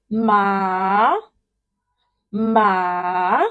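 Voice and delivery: MA drawn out, down then up; third tone. Shown as a demonstration. Teacher showing the third tone, dipping "ma". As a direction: dip "ma" slowly, then let it climb. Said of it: "falling and rising tone combined together".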